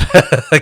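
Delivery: loud, high burst of laughter; big laugh. Real, not acted. Laughing hard to a friend about the backpack choice.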